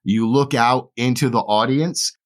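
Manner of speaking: ordinary talk, nothing marked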